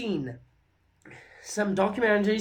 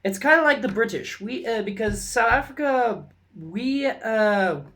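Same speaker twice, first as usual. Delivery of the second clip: usual speaking voice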